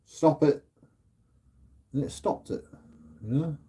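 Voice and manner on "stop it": short, sharp and higher "stop it"; firm command to the dog. Retold or imitated. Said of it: "And I'm like".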